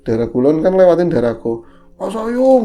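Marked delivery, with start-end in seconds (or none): 2.0-2.7 high, loud call across the street; calling from afar. Retold or imitated. Cracker seller calling out to the narrator from far off. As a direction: call his name from a distance.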